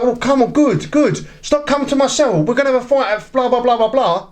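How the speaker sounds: high-pitched, goading retort; mock challenge at normal volume; retold fighting talk. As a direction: imitate lads squaring up, pitch jumped up.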